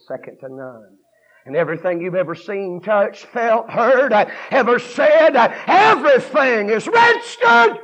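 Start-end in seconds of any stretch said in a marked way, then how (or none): 1.4-7.8 loud, raised sermon building to a high shout; fired-up preaching. Real, not acted. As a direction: preach harder and higher toward the last line. Preaches at full force.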